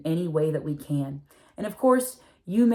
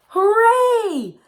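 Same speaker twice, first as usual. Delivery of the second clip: much higher, louder cry of delight; joyful cheer; 10 dB louder than usual. Acted. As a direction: burst out cheering as the fish return. Fish come back to reef.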